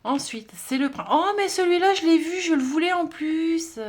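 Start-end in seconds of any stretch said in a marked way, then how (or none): 1.1-3.8 high, loud burst of delight; excited discovery. Real, not acted. Vlogger spots the card she wanted, excited and high.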